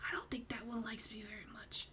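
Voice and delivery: conspiratorial whisper behind his hand; whispering gossip about the bartender; whispered aside. Acted. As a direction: hush it like a secret to the table. NPC confides aside to players.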